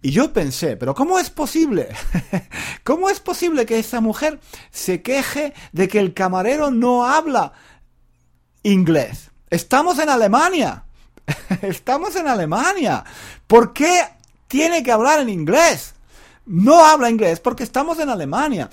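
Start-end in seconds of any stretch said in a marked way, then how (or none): 0.8-10.8 can't believe what he just heard; indignant disbelief. Retold or imitated. His indignant inner thought. 11.6-18.7 higher and louder, truly exasperated; indignant rant. Real, not acted. Indignant rant about the tourist.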